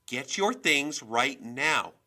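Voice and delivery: loud, high, drawn-out command; furious parent's shouted order; yelled order. A narrator's reading. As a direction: yell it slowly, word by word. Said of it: "she yelled".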